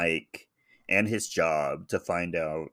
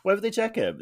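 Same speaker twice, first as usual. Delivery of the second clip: pitched way up in mock disbelief; sarcastic echo; 13 semitones higher, faster than usual. Echoing her co-host's point in mock disbelief. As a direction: echo it back, high and incredulous.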